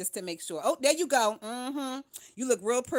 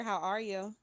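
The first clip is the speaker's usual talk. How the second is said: ordinary delivery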